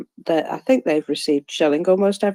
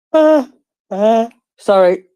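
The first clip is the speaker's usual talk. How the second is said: yawning; 5 semitones higher, 6 dB louder than usual. Real, not acted. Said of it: "I have to yawn my head off".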